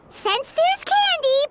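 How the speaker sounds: perked up by candy. Acted. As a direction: let the word candy change your mind. Paimon perks up at candy.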